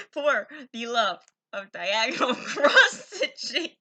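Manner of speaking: loud, slow, breaking into laughter; helpless laughter stretching out the words; laughing hard. Real, not acted. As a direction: crack up halfway through the phrase. Cracks up retrying the tongue-twisting phrase.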